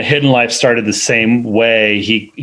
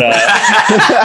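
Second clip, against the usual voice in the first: ordinary talk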